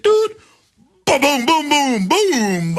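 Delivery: high, excited booming sound effects; vocal boom boom boom; mock explosions. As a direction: make the blasts with your voice, rising then falling. Mimicking explosions.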